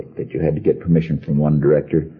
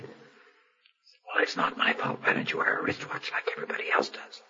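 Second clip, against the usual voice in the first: hushed, complaining whisper; 12 dB quieter than usual. Retold or imitated. Grumbling at a friend during a funeral, whispering. Hushed squabble in chapel pew.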